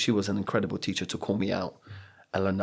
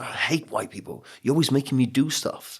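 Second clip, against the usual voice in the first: retold angry outburst; 5 semitones higher than usual. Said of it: "I just got so angry, so frustrated".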